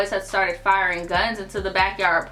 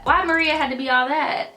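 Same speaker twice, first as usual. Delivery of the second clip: higher, rising incredulous scoff; exasperated mock; 6 semitones higher than usual. Real, not acted. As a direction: push the disbelief further.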